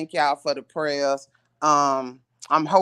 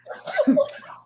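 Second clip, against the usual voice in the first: breathy high laugh. Real, not acted. Laughing after cussing.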